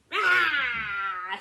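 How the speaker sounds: sudden loud ah; pouncing shout. Acted. Wolf jumps from hiding at the rabbit, sudden shout. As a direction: leap out with a roar.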